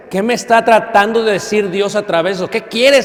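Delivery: mild urgent pleading. Acted. Preacher voices young men asking God in tragedy, urgent questioning. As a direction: act out a believer pressing God for an answer.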